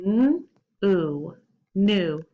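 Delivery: "n, oo, new" split into sounds then blended; sounding out new. Shown as a demonstration. Teacher sounding out "new" for kids, slow and clear. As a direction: stretch "oo" then join into "new". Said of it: "try it with me".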